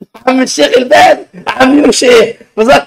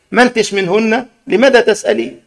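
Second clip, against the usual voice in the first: usual speaking voice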